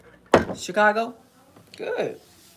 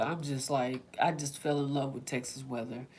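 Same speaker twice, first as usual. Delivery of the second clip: normal talking voice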